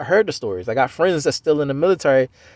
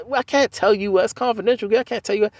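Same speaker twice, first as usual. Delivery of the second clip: imitating girlfriend; 8 semitones higher than usual. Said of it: "And I".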